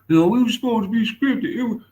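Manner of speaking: high, loud put-on voice; hammed-up high whine about the script; high loud mock protest; mock complaint. Imitating hurt boxer.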